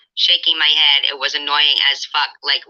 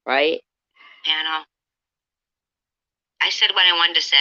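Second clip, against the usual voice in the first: usual speaking voice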